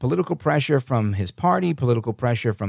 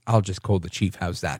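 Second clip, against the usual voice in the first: lower, imitated British threat; mock British accent; 6 semitones lower than usual. Imitating the candidate to the officer's face, lower British accent. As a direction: imitate a British driver, low and falling away. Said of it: "looks at her in the face and says".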